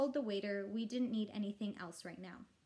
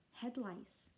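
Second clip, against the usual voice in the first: almost a whisper; 6 dB quieter than usual. A narrator's reading. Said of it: "almost in a whisper".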